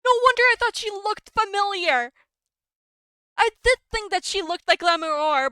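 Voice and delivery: loud, high burst of realization; astonished realization. Real, not acted. Player realizing why a character looked familiar, astonished. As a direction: exclaim it high as it dawns on you.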